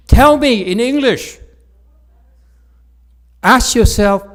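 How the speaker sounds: exasperated. Real, not acted. Teacher venting at Chinese phrasing.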